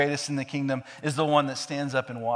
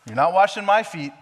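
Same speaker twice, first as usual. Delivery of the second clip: higher, louder flat refusal; stubborn refusal; 6 semitones higher, 8 dB louder than usual. Retold or imitated. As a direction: dig your heels in. Peter refusing Jesus, acted.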